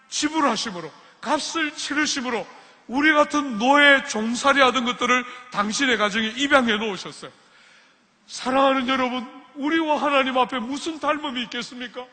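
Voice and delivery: ordinary talk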